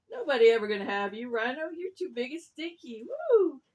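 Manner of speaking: loud taunt rising to a high whoop; teasing rooster with a loud final woo; mocking rooster. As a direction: jeer at the rhino, ending on a high whoop. Rooster mocks the rhino.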